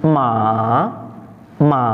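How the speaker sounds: drawn-out third-tone "ma", falling then climbing; swinging third tone. Shown as a demonstration. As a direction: swing "ma" down and back up. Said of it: "di ayun".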